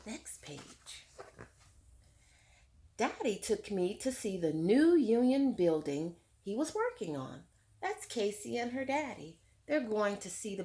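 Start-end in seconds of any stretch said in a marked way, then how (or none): 3.0-7.4 clear, fuller read-aloud voice to the class; story narration. A narrator's reading. Teacher reading Tar Beach to her class, lively read-aloud. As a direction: read it out like a picture book for children. Reads book text aloud.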